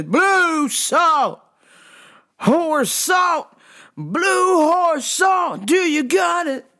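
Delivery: mock customer yell, high and drawn out; imitated yelling. Customer to worker four feet away, yelling. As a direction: bellow each word like he can't hear himself. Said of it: "Why are you yelling?".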